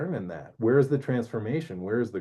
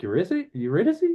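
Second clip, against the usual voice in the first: higher, questioning call of the name; uncertain calling; 6 semitones higher, faster than usual. Retold or imitated. Orpheus hears nothing behind him, lightly acted anxious calling. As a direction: imitate someone tentatively checking for a lost lover. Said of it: "he doesn't hear anything. He's like".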